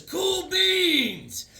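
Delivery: high, shouted catchphrase; excited shout. Retold or imitated. The boy kicks the boots and shouts his magic words. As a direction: yell it out as he powers up.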